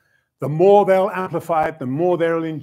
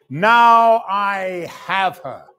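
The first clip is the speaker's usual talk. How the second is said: triumphant; 4 semitones higher, 5 dB louder, much slower than usual. Acted. Triumphant aside in debate.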